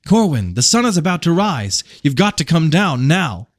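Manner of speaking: Random's voice calling him down. A narrator's reading. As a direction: call him down urgently. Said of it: "The voice of Random".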